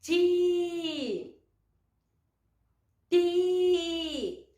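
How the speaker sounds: Ti with the i held long; blended syllable demo. Shown as a demonstration. Teacher joining T and I, sounded out. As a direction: draw out each Ti steadily. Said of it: "vai fazer um sonzinho de uma sílaba".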